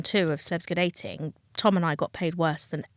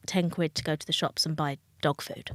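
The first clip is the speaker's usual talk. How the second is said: ordinary delivery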